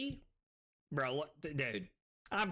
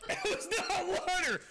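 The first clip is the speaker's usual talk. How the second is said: high yell through laughter; laughing outcry; 11 semitones higher, 4 dB louder than usual. Real, not acted. Streamer learns the drink was not water, high yell. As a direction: yelp it, choked and cracking up. Outburst after the prank drink.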